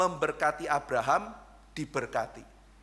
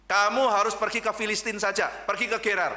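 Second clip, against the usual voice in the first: louder, higher divine command; God firmly directing Isaac where to go; 5 semitones higher, 5 dB louder than usual. Retold or imitated. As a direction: give the order with authority.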